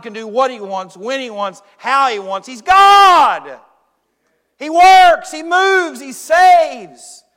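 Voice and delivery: building to a full preaching shout; fiery preacher crying out. Acted.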